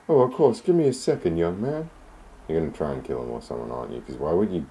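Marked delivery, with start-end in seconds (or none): 0.1-1.9 very high, loud granny voice; sweet old lady. Acted. Landlady offering tea to the visitor, high and sweet.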